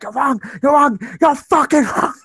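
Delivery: mock furious yell at a protester; retold angry shout. Retelling a woman yelling at an anti-abortion protester, mock angry shout. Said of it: "She was angry, shouting".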